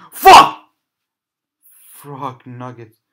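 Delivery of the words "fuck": sudden high scream; jump scare laugh. Real, not acted. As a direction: scream it as it jumps.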